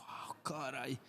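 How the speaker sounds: breathy whispered "Ah, caray" of dismay; stunned little whisper. Retold or imitated. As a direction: whisper "Ah, caray" as if taken aback. Father reacts to son's words.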